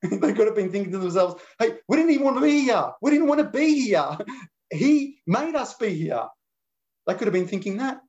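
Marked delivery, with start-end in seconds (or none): 1.6-6.3 protesting disciple. Retold or imitated. Preacher voicing protesting disciples.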